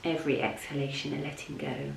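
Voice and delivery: soft, level voice with long pauses; unhurried, hushed yoga nidra guidance; guided meditation. Real, not acted. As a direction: guide them gently down into rest.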